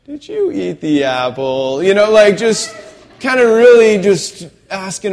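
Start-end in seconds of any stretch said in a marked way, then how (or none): 0.0-1.8 knowing parent's mock interrogation; questioning parent. God to Adam and Eve, like a questioning parent. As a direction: ask it like a parent who already knows. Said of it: "like, a parent saying this".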